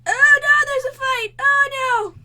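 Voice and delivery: high loud cries of alarm; real alarm; alarmed shout. Real, not acted. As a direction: shout as a fight erupts. Fight breaks out in game.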